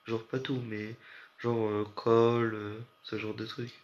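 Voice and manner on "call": slow, drawn-out Québécois "call"; a light Québécois accent on "call". Retold or imitated. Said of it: "Je sais pas faire l'accent québécois".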